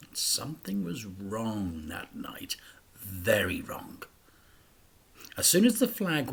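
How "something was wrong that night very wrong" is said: low, soft whisper; a narrator whispering that something is wrong; ominous whisper. A narrator's reading. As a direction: hush it like a dark secret.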